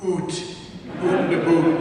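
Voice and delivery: "oot" and "boot" with a long oo; exaggerated Canadian vowel. Shown as a demonstration. Canadian mocking bad imitators, "oot" with exaggerated oo. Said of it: "Because we do not say".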